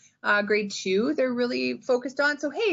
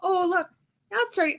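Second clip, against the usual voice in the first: high, excited storytime voice; excited teacher; 7 semitones higher than usual. Shown as a demonstration. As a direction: point out a discovery to young kids. Teacher pointing out picture to kids.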